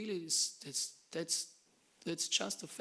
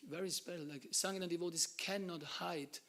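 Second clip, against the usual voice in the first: ordinary talk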